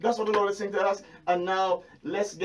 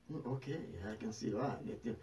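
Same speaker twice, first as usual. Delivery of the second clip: quiet inner voice; 8 semitones lower, 15 dB quieter than usual. Retold or imitated. Quiet inner reply to himself.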